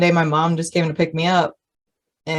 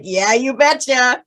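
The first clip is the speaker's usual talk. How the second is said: put-on Minnesotan accent reply; 7 semitones higher, slower than usual. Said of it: "her Minnesotan accent comes out".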